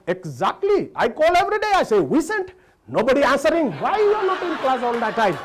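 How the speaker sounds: put-on high, loud insisting Indian-accented voice; mock Indian teacher. Indian teacher insisting to the boy, loud high accent. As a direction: insist loudly with an Indian lilt.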